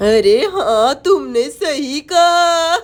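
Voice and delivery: low, slow sarcastic witch. Acted. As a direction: drag it out like a witch. Witch answers the girl.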